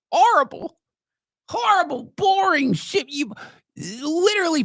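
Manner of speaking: mocking the chore as a whiny student; high mock disgust. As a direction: whine it like a bored student.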